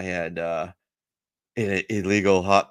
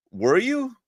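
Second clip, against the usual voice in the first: high, innocent mock question; cheeky comeback; 13 semitones higher than usual. Marine sasses his Gunny back, high and mock-innocent. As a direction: toss the question back like a smartass.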